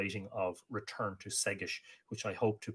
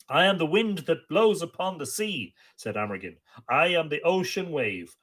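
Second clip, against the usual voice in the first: poem recitation; 8 semitones higher, 7 dB louder than usual. Acted. Amergin landing on Ireland proclaims his song, declaimed. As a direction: proclaim each line like an ancient bard.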